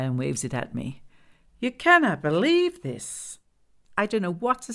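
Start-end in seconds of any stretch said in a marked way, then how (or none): 1.6-3.4 high, slow, loud disbelief; indignant Glenda character. Acted. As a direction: draw it out in outraged high voice.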